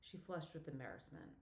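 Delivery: ordinary delivery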